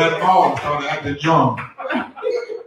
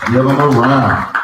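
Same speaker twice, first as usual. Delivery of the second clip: hype call to crowd; 7 dB louder than usual. Real, not acted. Host repeats the call to the crowd.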